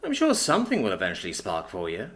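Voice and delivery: laid-back, self-assured loner; cool mechanic voice. Acted. Brandy gives advice.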